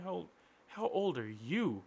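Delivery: slow, incredulous echo of the question; mocking echo. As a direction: repeat it back slowly in disbelief. Speaker echoes question slowly.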